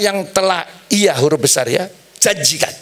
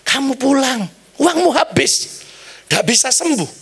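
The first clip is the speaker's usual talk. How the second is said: higher-pitched imitation of a dismissive doctor; retold doctor's verdict; 8 semitones higher than usual. Preacher quotes doctors abroad sending the patient home, high-pitched mock verdict. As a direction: pronounce the hopeless verdict high, without extra volume. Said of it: "dokter mesti bilang".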